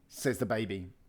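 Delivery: ordinary delivery, nothing marked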